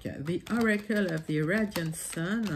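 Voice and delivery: French speaker putting on an English accent. Shown as a demonstration. Reader announces her next oracle deck, in a put-on English accent. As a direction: say it in playful, showy English. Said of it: "J'adore mon accent anglais".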